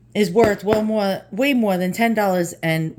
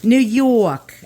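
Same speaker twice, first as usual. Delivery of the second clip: exaggerated New York accent, YORK drawn out; broad, stretched-out New York vowels on YORK; 3 semitones higher, 5 dB louder than usual. Shown as a demonstration. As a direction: lean hard into a slow New York accent. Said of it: "I have a New York".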